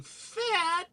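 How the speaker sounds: very high, soft squeal; small high-pitched mock-female echo; offended girl's reaction. Comic-book girl hearing she's called fat, high soft mock squeak. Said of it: "And she's going".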